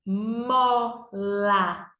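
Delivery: Mola drawn out as "mmmo-llla"; stretched syllables. Shown as a demonstration. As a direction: stretch Mola slowly into both parts. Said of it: "Duas sílabas". (Mola esticado: "mmmo-llla")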